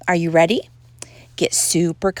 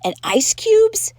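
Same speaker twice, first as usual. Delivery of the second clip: very high, louder question; asking; 13 semitones higher, 5 dB louder, slower than usual. A narrator's reading. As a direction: ask high and drawn out like a hopeful kitten. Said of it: "asked Skippy".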